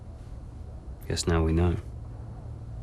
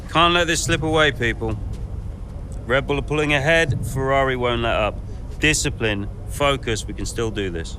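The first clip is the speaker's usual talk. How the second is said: rallying call; 7 semitones higher than usual. Acted. Boss rallies the team.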